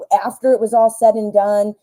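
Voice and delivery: usual speaking voice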